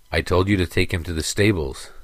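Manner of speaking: usual speaking voice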